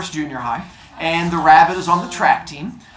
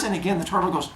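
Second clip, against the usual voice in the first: plain reading voice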